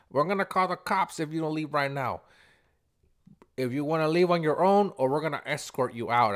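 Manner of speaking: firm threat, acted out for the story; retold warning. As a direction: imitate an owner laying down the law. Owner threatens customer in retold quarrel.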